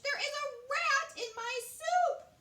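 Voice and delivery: high, loud horrified cry; screeching queen. A narrator's reading. Queen shrieking about the rat in her soup. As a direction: shriek the complaint. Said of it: "she screeched".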